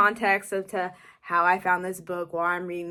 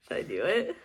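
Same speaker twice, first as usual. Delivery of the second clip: soft, low, sneaky asking; quiet tempted question; 4 semitones lower, 6 dB quieter than usual. Real, not acted. Tempted to read the last sentence, asking herself softly. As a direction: whisper the temptation to yourself. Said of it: "I really wanna go and read this last one".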